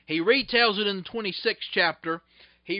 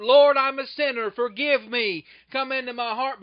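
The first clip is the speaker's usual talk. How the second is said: raised, louder mock call to the Lord; mock sinner's prayer; 3 semitones higher, 7 dB louder than usual. Preacher mimicking people who only pray, called out loudly. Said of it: "there will be people who say".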